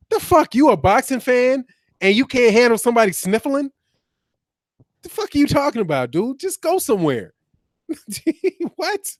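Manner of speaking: high-pitched, incredulous scoffing; real indignant disbelief. Real, not acted. Streamer answering a boxing fan upset by sniffling, indignantly incredulous. Incredulous at viewer's petty complaint.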